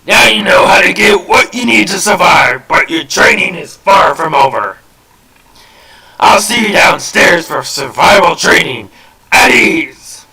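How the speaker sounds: loud, high commanding drill sergeant; general's voice. Acted. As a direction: bark it like a military general. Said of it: "doing the general's voice".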